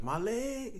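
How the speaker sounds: squeaky cartoon cry of pain; high-pitched cartoon wail; SpongeBob impression. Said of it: "on that SpongeBob".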